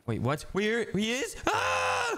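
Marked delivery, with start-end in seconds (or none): 1.5-2.2 startled scream. Acted. Told Grimace is here.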